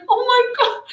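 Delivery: high, shocked oh my God; mock gasp. Hosts reacting to a sad preview, shocked and high. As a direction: gasp it like the news just hit. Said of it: "we were like".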